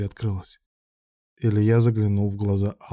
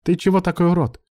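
raised, high-pitched indignant question; angry outburst; 8 semitones higher, 4 dB louder than usual. A narrator's reading. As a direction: throw the insult out in indignation. Angry quarrel, outburst continues. (возмущённо, на повышенных тонах)

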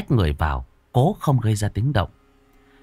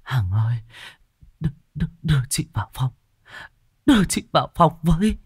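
weak, broken, higher-pitched begging; feeble gasped plea; 6 semitones higher, faster than usual. Acted. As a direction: plead faintly, as if barely conscious. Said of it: "miệng thều thào".

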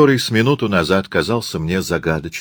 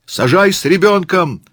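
high, raised crowd yell; demanding shout; 11 semitones higher, 4 dB louder than usual. Acted. Tourist wants child boarded first, shouting. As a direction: yell a demand over the din.

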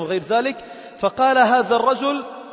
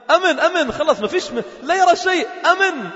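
fervent exclamation; 8 semitones higher than usual. Real, not acted. Preacher to listeners, crying out in high fervour. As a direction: cry out the point with rising passion. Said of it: "preacher exclaims on faith's security".

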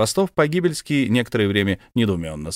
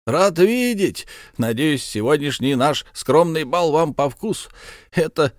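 jovial host count; 7 semitones higher than usual. Acted. Host to his guest, a high, hearty welcome. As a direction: play the hearty old count, arms wide.

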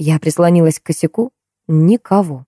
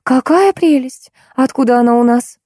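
delighted cry; 7 semitones higher than usual. A narrator's reading. Wife spotting the little dog, delighted high cry. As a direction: gush in a high voice, stretching the words. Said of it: "закричала Серёжкина жена".

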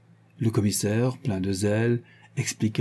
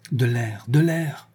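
gasping exclamation; 3 semitones higher, slower than usual. A narrator's reading. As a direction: call out for air as if stifling. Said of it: "s'exclama-t-elle". (s'exclamer en manquant d'air, voix qui monte)

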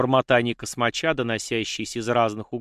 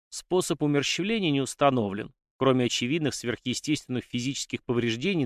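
usual speaking voice

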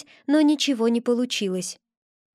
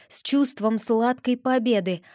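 plain reading voice

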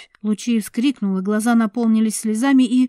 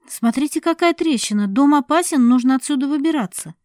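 higher, louder pointing at a crack; alarmed cry; 6 semitones higher, 6 dB louder than usual. A narrator's reading. Sasha warning everyone of the crack, higher and louder. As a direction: raise the alarm.

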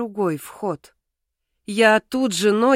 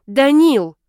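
higher, louder cry of protest; pained shout; 5 semitones higher, 8 dB louder than usual. A narrator's reading. Heroine calling on partner to stop, pained shout. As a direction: shout the name in alarm.

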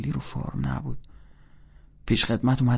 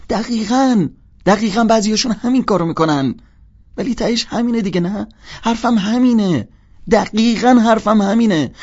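put-on higher, loud insistent girl voice; Sally voiced; 12 semitones higher, 12 dB louder than usual. Sally agreeing loudly and insistently with Holden, higher-pitched. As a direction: press the point eagerly in her voice.

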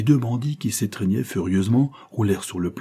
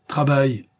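sharp, raised command; harsh order; 6 semitones higher, 4 dB louder than usual. A narrator's reading. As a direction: throw the order out, high and forceful. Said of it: "en lançant cette affreuse parole".

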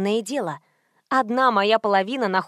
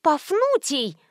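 high exclamation of recognition; sudden realisation; 6 semitones higher, slower than usual. A narrator's reading. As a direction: cry out as it dawns on you. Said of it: "осенило меня".

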